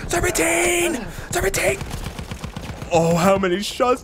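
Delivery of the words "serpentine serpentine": high-pitched squealed call; mock panicked cry. Gamer dodging fire, high mock-panicked call. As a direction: cry it out like a battle order. Calls out evasive move under fire.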